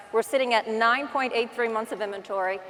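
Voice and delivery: usual speaking voice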